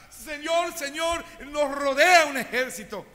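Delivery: high, loud alarmed cry to his master; panicked servant. Acted. Giesi cries to Eliseo in panic about the army.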